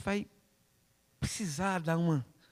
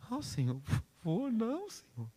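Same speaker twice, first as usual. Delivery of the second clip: whining refusal; 7 semitones higher, 5 dB quieter than usual. Acted. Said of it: "E Deus fala".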